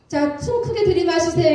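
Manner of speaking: brisk mock X-ray room instruction; lightly acted technician's command; relayed clinic instruction.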